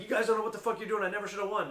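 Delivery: fast, high, loud mock complaint; imitated heated pro. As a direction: rattle off the protest as a worked-up competitor. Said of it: "Rune got heated".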